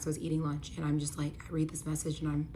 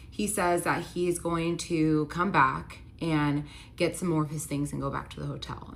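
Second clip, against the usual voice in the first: usual speaking voice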